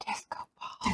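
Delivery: hushed disco ball; a secret little whisper. Retold or imitated. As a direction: breathe it out in a whisper. Both said disco ball together.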